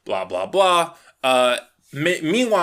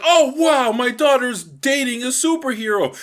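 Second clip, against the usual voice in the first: delighted dad; 8 semitones higher than usual. Retold or imitated. Dad overjoyed seeing his daughter with a superhero, high and delighted. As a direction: burst with a proud father's glee. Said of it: "cheers up".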